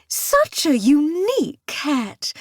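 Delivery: high, slow, pointed sweetness; catty counter. A narrator's reading. Primula countering her rival, high and slow. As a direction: return the jab with sweet venom. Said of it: "Primula countered".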